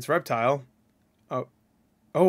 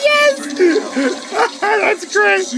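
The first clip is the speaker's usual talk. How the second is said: laughing cheer; 6 dB louder, much slower than usual. Real, not acted. Delighted by fatality animation.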